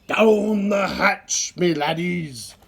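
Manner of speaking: loud, very slow pirate toast; pirate yell. A narrator's reading. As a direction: bang the table and roar. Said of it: "pounded his fist on the table and yelled".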